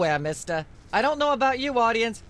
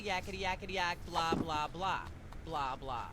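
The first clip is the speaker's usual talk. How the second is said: background chatter; 4 semitones lower, 15 dB quieter than usual. Acted. [name] drones on beneath talk.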